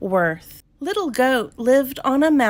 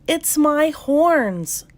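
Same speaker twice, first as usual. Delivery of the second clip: slow, high sigh of woe; a touch of sadness, drawn out; 7 semitones higher, slower than usual. A narrator's reading. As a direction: sigh the complaint out slowly. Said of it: "Little Goat sighed".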